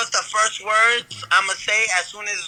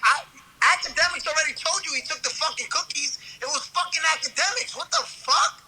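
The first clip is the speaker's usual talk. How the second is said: snitching outburst; 7 semitones higher, 4 dB quieter than usual. Retold or imitated. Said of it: "I'm gonna be like".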